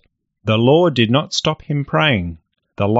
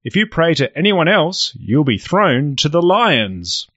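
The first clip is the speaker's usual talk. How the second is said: royal proclamation; 7 semitones higher than usual. Acted. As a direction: proclaim the law to the crowd. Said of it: "The law was read out".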